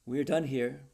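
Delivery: loud, heavy and final; grave firm pronouncement to the Duke. A narrator's reading. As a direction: end the deal with weight. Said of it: "said Perez gravely".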